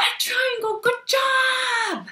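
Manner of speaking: high, loud cheery praise; excited praise. Real, not acted. Praising the child's answer.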